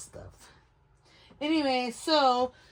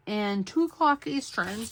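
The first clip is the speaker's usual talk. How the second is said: normal talking voice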